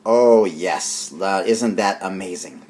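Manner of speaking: normal talking voice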